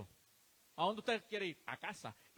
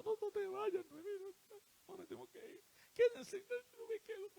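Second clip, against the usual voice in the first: put-on high, crying plea of the young women; weeping young women; 11 semitones higher than usual. Daughters-in-law pleading with Naomi not to go, high and tearful. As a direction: beg her not to leave, high and tearful.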